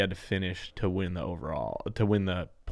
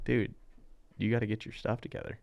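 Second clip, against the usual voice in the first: ordinary delivery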